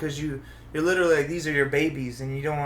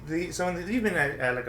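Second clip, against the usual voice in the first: ordinary talk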